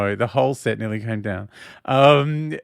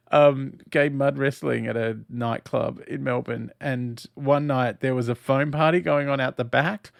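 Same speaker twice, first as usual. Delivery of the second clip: normal talking voice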